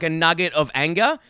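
ordinary talk, nothing marked

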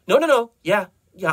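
hasty, high, loud interruption; eager agreement. Acted. Desmond hastily agreeing to another session, eager. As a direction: jump in too eagerly.

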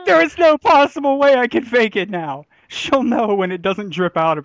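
panicked. Retold or imitated. Panicked retold thought.

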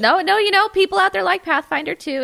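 laughing, high and loud. Real, not acted. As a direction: blurt it out through a laugh. Teasing defence of Pathfinder players.

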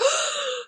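sharp, high gasp of fright; big horrified intake of breath. A narrator's reading. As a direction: suck in a startled breath. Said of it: "he held his breath".

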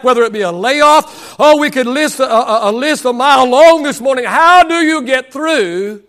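impassioned sermon delivery; rolling pulpit cadence; preaching. Real, not acted. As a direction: preach it with driving force, stretching key words. Preaches at full force.

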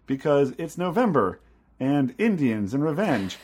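mock eager idea pitch. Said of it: "you came to me and said".

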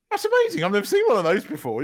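high, loud retold amazement; re-enacted wide-eyed excitement; amazed reaction. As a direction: gush over a clever gadget. Retells own amazed reaction to gadget.

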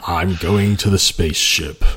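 low, slow, too-epic hero voice; slow, deep, portentous parody; Peter Cullen. Acted. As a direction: intone it with mock grandeur. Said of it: "Peter Cullen is too epic".

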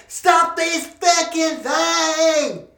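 high, slow panicked scream. Acted. Claustrophobic man screaming at the elevator. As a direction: scream to make it stop. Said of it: "He screamed".

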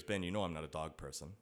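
low, quiet deadpan objection; understated, low-voiced refusal; unimpressed fiancée. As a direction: imitate her unimpressed reply, low and soft. Fiancée's unimpressed reply to dog news.

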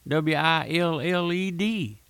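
slow, letter by letter: W I L L E D; spelled out. Shown as a demonstration. Preacher spelling the word out slowly for his listeners. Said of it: "And I say it like this".

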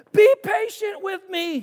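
high, loud begging cry. A narrator's reading. Servant on his knees begging master, high loud plea. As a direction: plead for your life. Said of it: "he begged".

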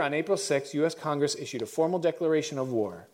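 ordinary talk

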